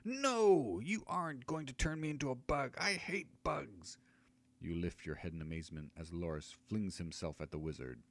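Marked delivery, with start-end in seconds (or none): 0.1-4.0 high, shrill-pitched scream of a small halfling; high halfling screaming in panic; halfling's furious scream. Acted. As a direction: shriek it high and desperate. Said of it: "the halfling's furious voice screams".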